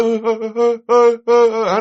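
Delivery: high, repeated "uh" of sudden confusion; cursing stalls into stunned, high stutters; dumbfounded stammer. Shown as a demonstration. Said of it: "là kiểu vậy đó". (ơ ơ sững sờ, cứng họng)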